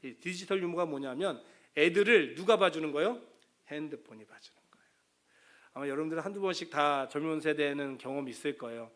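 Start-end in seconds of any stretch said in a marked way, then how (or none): 3.7-4.9 confiding stage whisper. Real, not acted. Preacher whispering the punchline to the congregation.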